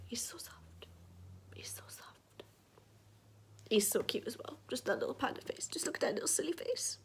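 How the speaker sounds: soft whisper over a cute toy. Real, not acted. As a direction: breathe the words, cooing. Cooing over soft toy panda.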